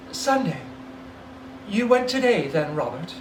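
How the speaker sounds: wife guessing where he went; Mrs Cratchit, anxious. Acted. Wife asking Bob about the grave, anxious. Mother voiced.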